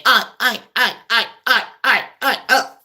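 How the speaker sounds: stuttering like a stuck machine; mock stammering president. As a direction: imitate a doddery leader stuck on one word. Mocking confused old president malfunctioning.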